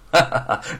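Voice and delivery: short acted laugh; brief hearty chuckle in character; old man's chuckle. Old veteran laughs at a lucky meeting, short acted chuckle.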